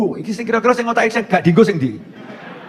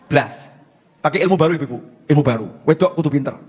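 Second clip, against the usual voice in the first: usual speaking voice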